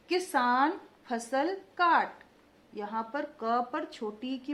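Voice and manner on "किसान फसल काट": slow, louder, every syllable clear; slow dictation. Shown as a demonstration. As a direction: dictate it slowly to the class. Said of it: "देखते जाइए मैं कैसे उच्चारण कर रही हूँ".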